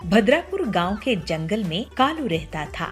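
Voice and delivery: usual speaking voice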